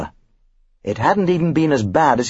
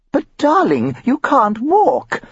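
much higher, drawn-out wife voice; high, slow plea; 11 semitones higher, much slower than usual. A narrator's reading. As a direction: stretch it out, high and pleading. Wife timidly objects.

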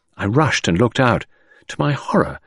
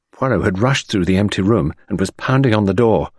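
ordinary delivery

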